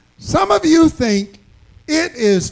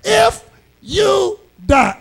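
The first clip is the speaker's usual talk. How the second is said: If stressed high and slow; drawn-out, higher If, imitating a salesman; salesman's iffy pitch; 7 semitones higher, slower than usual. Preacher mimicking an insurance salesman, stress on If.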